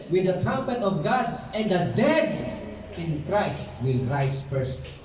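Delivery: rising preacher's reading of scripture, slowing at the end; sermon scripture reading. Real, not acted. Scripture read aloud, rising.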